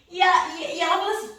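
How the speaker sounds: ordinary talk, nothing marked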